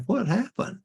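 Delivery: high startled question; high, puzzled alarm; surprised. Retold or imitated. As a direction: pop it up high in dismay. Retold surprise at pink underwear.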